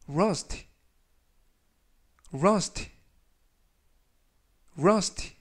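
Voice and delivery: rust said clearly with a rising end; 또박또박 rust 발음 시범; demo pronunciation. Shown as a demonstration. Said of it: "라고 발음하시면 되겠습니다". (끝을 올리며 rust 시범)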